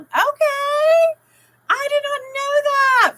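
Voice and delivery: high, loud drawn-out amazement; surprised squeal. Real, not acted. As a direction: squeal it in astonishment. Surprised at British slang.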